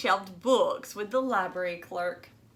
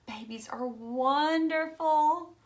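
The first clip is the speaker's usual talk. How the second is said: high, slow, doting; fond high cat thought; adoring cat voice; 6 semitones higher, slower than usual. A narrator's reading. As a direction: gush over the babies.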